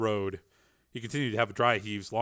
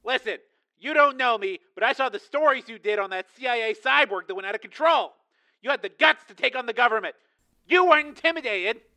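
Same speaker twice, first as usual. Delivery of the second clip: much higher, urgent pleading voice; desperate caller; 11 semitones higher, 5 dB louder than usual. A narrator's reading. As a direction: press her urgently. Tracy voiced on phone.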